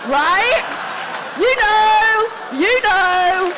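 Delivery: high, shouted exclamation; loud appeal. Real, not acted. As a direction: shout it out to the crowd. Calls out to the audience.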